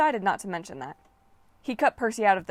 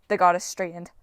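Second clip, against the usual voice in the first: usual speaking voice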